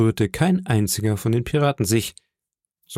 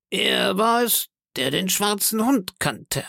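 put-on high-pitched, drawn-out old sailor voice; Morgan; 10 semitones higher than usual. Old sailor accuses the boy, high and drawn out. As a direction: accuse in a high, stretched seaman's voice.

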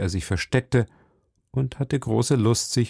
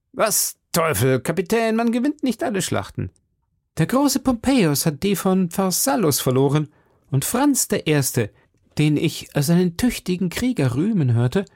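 Aramis; 7 semitones higher than usual. A narrator's reading. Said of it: "sagte Aramis".

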